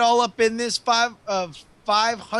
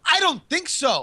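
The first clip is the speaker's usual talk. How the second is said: smug refusal; 7 semitones higher than usual. Acted. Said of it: "Pro X P N says".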